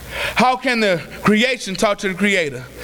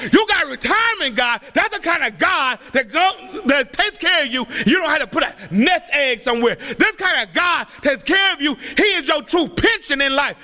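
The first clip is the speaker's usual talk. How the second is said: raised, ringing preacher's voice with shouted peaks; preaching at full force; 4 semitones higher than usual. Acted. Preacher urging the congregation to trust God's plan, fervent.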